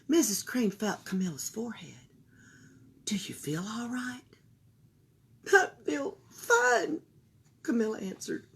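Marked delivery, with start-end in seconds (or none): 3.0-4.3 soft whispered concern; mother checking her daughter's forehead; worried whisper. Acted. 5.5-7.0 high, slow, drawn-out fine; a small girl protesting she's fine; child voice. Acted. Camilla insists.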